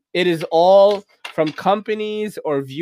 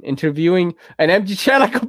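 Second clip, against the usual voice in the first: usual speaking voice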